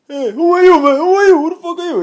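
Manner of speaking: high, loud, funny comedian voice; exaggerated high-pitched celebrity impression; Tracy Morgan. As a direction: squawk it out as a comic impersonation. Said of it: "he talks really funny".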